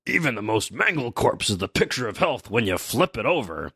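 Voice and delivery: theatrical giant voice; Brogy. Acted. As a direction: play a giant declaiming with swooping pitch. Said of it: "Brogy says".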